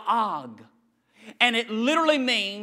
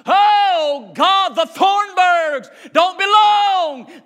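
high, loud, slow anguished cry; devil's wail; 10 semitones higher, 12 dB louder, much slower than usual. Acted. Devil wailing to God over a lost family.

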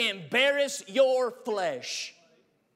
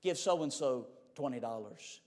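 much lower and much softer, far off; a low, hushed divine command; God's quiet low-voiced order; 10 semitones lower, 9 dB quieter than usual. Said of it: "For you, it may be".

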